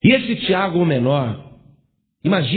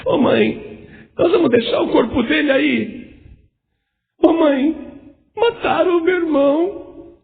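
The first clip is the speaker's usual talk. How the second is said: rising sobbing plea, breaking into a cry; a grieving brother crying out to his mother; 9 semitones higher than usual. Pretend. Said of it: "Você ia falar". (irmão caçula chorando e gritando para a mãe)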